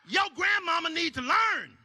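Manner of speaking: yelling in hammed-up outrage; mock yell. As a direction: blow up over nothing. Escalating fake fury.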